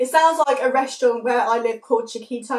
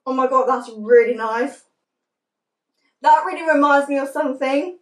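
talking behind hand over mouth; muffled words mid-chew; mouth full, chewing. Real, not acted. Seen 1: Chewing face before; hand covers mouth while speaking after bite.

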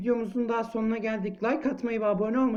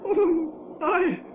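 shaken; 9 semitones higher, slower than usual. Real, not acted. Shaken after jump scare.

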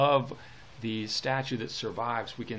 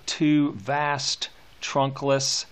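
"two vast trunkless" very slow, every T struck crisp and louder; stressing the T's; 4 semitones higher, 4 dB louder, much slower than usual. Shown as a demonstration. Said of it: "Lots of T's".

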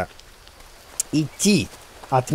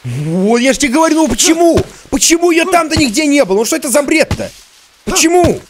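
loud, much higher exasperated shouting; exasperated yelling; 11 semitones higher, 5 dB louder than usual. Real, not acted. Gamer losing it after searching everywhere, exasperated yelling. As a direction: vent the frustration at full volume. Frustrated outburst at game.

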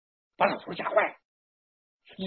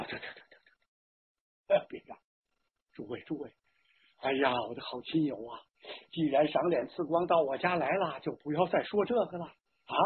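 put-on low, soft, pleading old father; subdued plea to calm a crowd; flustered hushing; 3 semitones lower, 6 dB quieter than usual. A narrator's reading. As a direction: stammer softly, then beg the guests. Flustered father hushes angry relatives.

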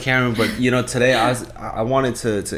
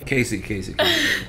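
laughing correction. Real, not acted.

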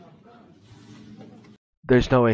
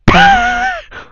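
very high, loud burst of laughter; real shriek of laughter; 10 dB louder than usual. Real, not acted. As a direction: let out a squealing laugh. Reacting to a slap clip.

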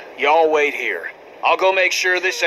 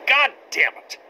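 angry outburst; 7 semitones higher than usual. Acted. Frustrated outburst on the trail.

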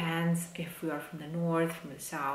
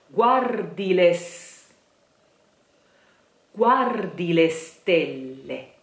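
guardile slow and louder; slow model; 5 dB louder, slower than usual. Shown as a demonstration. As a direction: draw guardile out.

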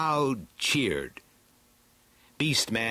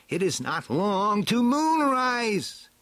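very high, stretched villain voice; Skeletor, urgent; 11 semitones higher, 4 dB louder, slower than usual. A narrator's reading. Skeletor warning his companions of moonrise, urgent. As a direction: climb high and hold the final word.

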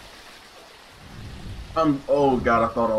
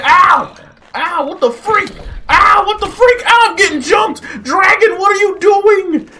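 high, loud outraged yelping; panicked shouting; 13 semitones higher, 6 dB louder than usual. Real, not acted. Player getting jumped to idle dragon, frantic shouting. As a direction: yell for help under attack.

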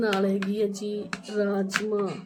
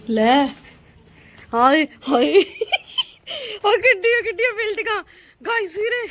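loud, high amazed exclaiming; dramatic loud aunty; 8 semitones higher, 6 dB louder than usual. Real, not acted. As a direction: gasp aloud at the view. Amazed at tall buildings. (ਨਾਟਕੀ ਉੱਚੀ ਆਵਾਜ਼ ਵਾਲੀ aunty)

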